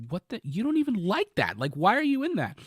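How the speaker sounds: baffled at the water-hating dog; shocked. Retold or imitated. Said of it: "because I was like".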